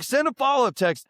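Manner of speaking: high, insistent instruction; retold urging. Telling friend to fix the text, high and insistent. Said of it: "I was like".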